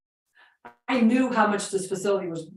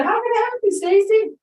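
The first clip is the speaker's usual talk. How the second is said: high shouted yeah down the phone; deaf old man screaming; 13 semitones higher, 6 dB louder than usual. Retold or imitated. Deaf old owner answering his phone, shouting. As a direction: yell it like a deaf old man who can't hear himself. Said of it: "He was screaming".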